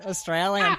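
"Australian" in an Australian accent, ending in a rising "alien". Shown as a demonstration. Showing a friend his one good Australian word, in an Australian accent. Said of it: "you give it the little alien, the little alien at the end".

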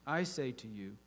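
lively, dramatic Bible reading; scripture reading. Acted. A preacher reading Matthew to his congregation, dramatic. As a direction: read the parable like a story to the church.

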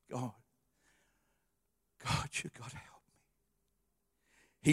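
slow, breathy whispered plea to God; whispered prayer. Pretend. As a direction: whisper a weak desperate prayer, slowly.

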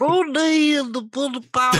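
put-on high-pitched, loud joke accent; Barack Obama. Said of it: "that's actually my Barack Obama accent".

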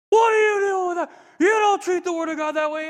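imitated high, furious shouting; ballistic dad. Dad to son over the dropped Bible, mock ballistic shouting. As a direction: play a father completely freaking out, loudly. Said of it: "My dad went ballistic, completely freaked out".

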